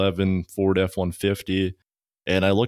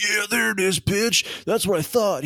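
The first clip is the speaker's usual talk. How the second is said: high-pitched mock outburst; worked-up, high-voiced gotcha; retold angry taunt; 13 semitones higher than usual. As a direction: imitate an irate scammer, pitched way up. Scammer snaps at the prank caller.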